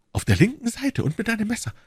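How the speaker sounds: high-pitched, astonished echo; awed confirmation. Acted. Queen confirming the dream he guessed, astonished. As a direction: repeat it high, in wonder.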